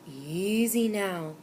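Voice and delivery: slow, calming murmur. A narrator's reading. Storekeeper calms the frantic boy with a slow murmur. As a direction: soothe him, slow and easy. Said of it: "murmured the storekeeper".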